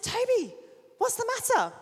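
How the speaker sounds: put-on high, loud call to a child in another room. Father calling his son who ran out. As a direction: call after him, worried and loud.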